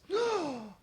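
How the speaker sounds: sharp shocked intake of breath; high gasp. Acted. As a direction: gasp in horror. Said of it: "punctured by gasps".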